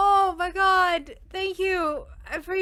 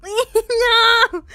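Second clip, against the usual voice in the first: panicked laughing scream; 4 semitones higher, 6 dB louder than usual. Real, not acted.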